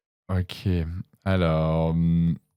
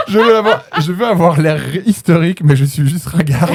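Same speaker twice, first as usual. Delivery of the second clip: laughing; 6 semitones higher than usual. Real, not acted. Cracks up reading the riddle.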